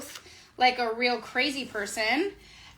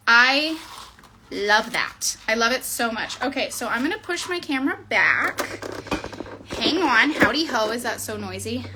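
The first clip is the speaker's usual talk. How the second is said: normal talking voice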